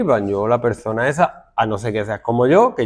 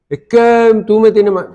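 mock whiny student; 8 semitones higher, 6 dB louder, slower than usual. Mocking a student's complaint.

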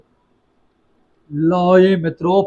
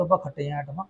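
ordinary delivery

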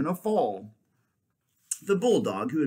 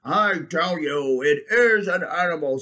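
insistent bulldog; 5 semitones higher, 6 dB louder than usual. Acted.

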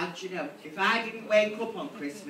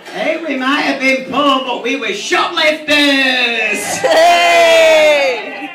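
loud, slow proud yell; shouted punchline; 7 semitones higher, 13 dB louder, much slower than usual. Real, not acted. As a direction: bellow it out to the crowd.

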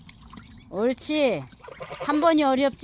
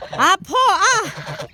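long drawn-out yelp of pain; real pain cry; 4 semitones higher, 5 dB louder, much slower than usual. Real, not acted. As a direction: cry out as the bite hurts. Bitten by a duck. (아파서 지르는 비명)

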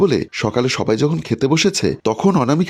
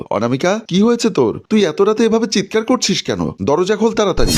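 high-pitched, urgent questioning; alarmed demand; 7 semitones higher than usual. A narrator's reading. Father outside daughter's room after her scream, alarmed demand. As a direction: demand an answer through the door, alarmed.

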